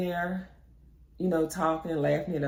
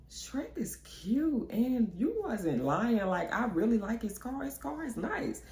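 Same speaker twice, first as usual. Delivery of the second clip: slow, soft, high teasing drawl; drawn-out approval; 8 semitones higher, 4 dB quieter, slower than usual. Retold or imitated. Admiring the date's car to her friend, slow and soft. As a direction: savour each word slowly. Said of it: "So I was like".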